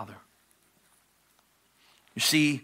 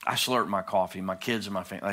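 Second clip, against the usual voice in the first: ordinary delivery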